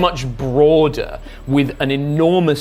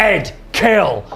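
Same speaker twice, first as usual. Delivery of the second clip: sharp order, higher and louder; barked command; 6 semitones higher, 3 dB louder than usual. Acted. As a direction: snap the order at the brute. Leader commands Big Ed.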